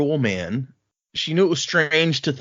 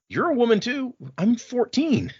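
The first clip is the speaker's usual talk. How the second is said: put-on much higher teen retort; exasperated girl; 11 semitones higher than usual. Shallan telling her brother she's only fourteen, much higher and exasperated. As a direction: throw it back at him.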